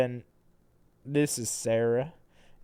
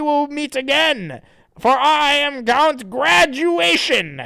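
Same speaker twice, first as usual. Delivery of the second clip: villain voice; 10 dB louder, slower than usual. Acted. Villain voice for comic character.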